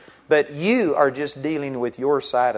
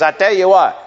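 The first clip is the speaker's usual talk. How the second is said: raised, louder voice of an angry pastor; retold railing; 5 semitones higher, 8 dB louder than usual. Pastor railing at the preacher, raised and loud. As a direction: lay into him like a scolding preacher. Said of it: "and he started railing on me".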